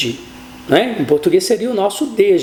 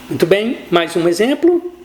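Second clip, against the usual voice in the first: normal talking voice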